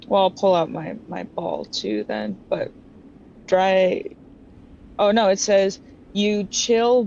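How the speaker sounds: ordinary talk, nothing marked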